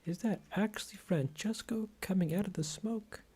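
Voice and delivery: high, loud astonished voice; amazed crowd member. Acted. Onlooker seeing the boss return, high excited disbelief. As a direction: gush in disbelief. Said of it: "And he goes".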